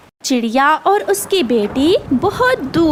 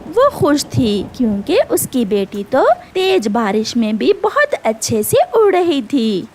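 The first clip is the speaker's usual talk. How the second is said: usual speaking voice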